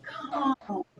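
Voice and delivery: "Calmly" softly breathed out on a slow exhale. Shown as a demonstration. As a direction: let "Calmly" ride out on the breath, soft. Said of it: "when we breathe out, we're gonna say".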